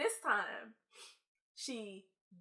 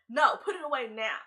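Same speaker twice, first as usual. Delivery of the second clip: loud, higher bossy order; stern teacher snap; 4 semitones higher, 8 dB louder than usual. Retold or imitated. Teacher telling the student to put the purse away, loud and stern. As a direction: bark it like a strict teacher.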